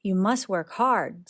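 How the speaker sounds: usual speaking voice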